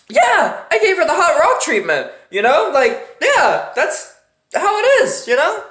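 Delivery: lightly voiced proud masseuse; a hint of a smug masseuse; breezy masseuse.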